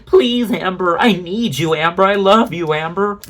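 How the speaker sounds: sarcastic fan pleading with Amber; mock adoring fan. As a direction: beg like a swooning fan, mockingly. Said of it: "People are".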